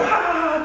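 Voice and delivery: very high "ah" of a crowd going nuts; imitated crowd roar. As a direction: imitate excited fans with a high cry. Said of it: "the crowd's like going".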